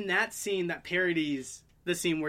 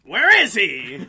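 mock villain yell; 14 dB louder, slower than usual. Goblin voice in burning building.

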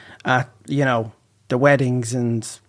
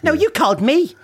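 mock quarrel; 12 semitones higher than usual. Dad protests to mom, mock quarrel. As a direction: insist you didn't call. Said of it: "the la".

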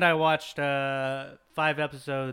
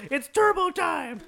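yelling. Retold or imitated. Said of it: "and then they yell".

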